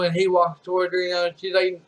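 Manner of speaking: usual speaking voice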